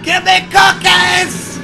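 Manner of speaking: high drawn-out scream. Retold or imitated. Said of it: "He's screaming at her".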